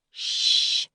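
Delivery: a hissed shh through the teeth; hissed shush. Acted. Friend shushing Eddie's pencil tapping, sharp hissed shh. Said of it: "Howie hissed".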